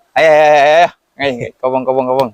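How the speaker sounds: brisk urgent calling out. Retold or imitated. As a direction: hurry them along, urgent. Calling kids off to kobong.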